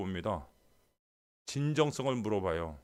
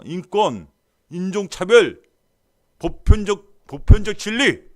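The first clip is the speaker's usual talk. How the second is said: each word higher and louder, last one slow; mocking crescendo; 6 semitones higher, 14 dB louder, slower than usual. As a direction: build the slogans up in sarcasm. Sarcastic rising list. (구호를 비꼬듯 점점 크게 외치기)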